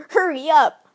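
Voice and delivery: put-on pushy kid hurrying someone along; retold impatient shout. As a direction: call out an impatient mock yell. Retold kid yelling behind him.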